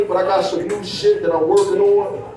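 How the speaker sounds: normal talking voice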